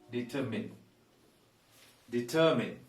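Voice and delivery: 'determine' ending in a short 'min'; model pronunciation. Shown as a demonstration. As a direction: say 'determine' as 'di-TER-min', not 'mine'. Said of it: "When pronouncing it".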